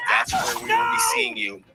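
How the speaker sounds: shouted falsetto, loud and high; goofy high-pitched yell; high squeaky shout. Pretend. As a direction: squeal it out like a cartoon. Playful put-on high voice.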